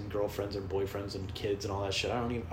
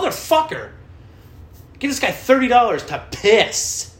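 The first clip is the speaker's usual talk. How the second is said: very high, loud mock outrage; outraged outburst; 13 semitones higher, 11 dB louder, slower than usual. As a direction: yell it indignantly, stretching the end. Acts outrage at wasted money.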